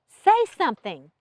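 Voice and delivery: loud, insistent demand; demanding, voice rising. A narrator's reading. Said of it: "her voice rising".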